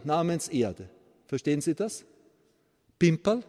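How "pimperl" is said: ordinary talk, nothing marked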